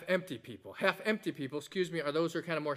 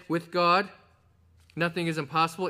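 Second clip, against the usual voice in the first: "With God" called out loud with a lift, then a pause; proclaimed from the pulpit; stress on With God; 7 dB louder than usual. Shown as a demonstration. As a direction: ring out "With God" before "nothing is impossible". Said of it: "I want to say it that way".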